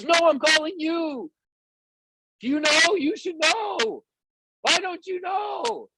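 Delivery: high, slow, indignant yelling; demanding shout. Retold or imitated. Downline member yelling at her upline, mock outrage. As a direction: hammer each question at the leader.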